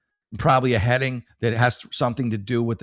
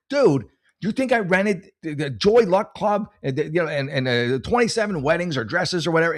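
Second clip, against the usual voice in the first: retold indignant protest; 6 semitones higher than usual. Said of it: "I'm like".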